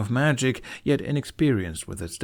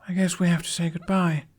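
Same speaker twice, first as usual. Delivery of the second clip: put-on young boy voice; 9 semitones higher than usual. Jamie voiced.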